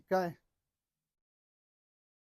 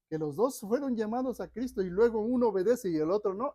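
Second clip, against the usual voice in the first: rising, louder preacher's push; preaching at full force; 4 semitones higher, 5 dB louder than usual. Acted. Preacher pressing the congregation, full-force preaching. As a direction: drive the point home from the pulpit. Preacher raising voice mid-sermon.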